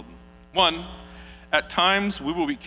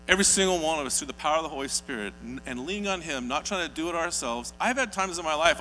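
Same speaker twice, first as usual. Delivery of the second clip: ordinary talk, nothing marked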